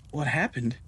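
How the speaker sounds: ordinary delivery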